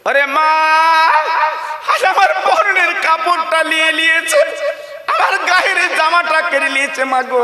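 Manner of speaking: keen it like a mourning cry; wailing son. Acted. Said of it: "ছেলে কেঁদে কেঁদে বলছে".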